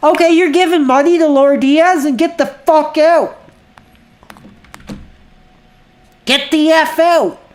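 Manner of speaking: yelled high and loud, banning the troll; furious shout. Real, not acted. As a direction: roar at the troll to get out.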